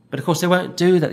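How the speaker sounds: normal talking voice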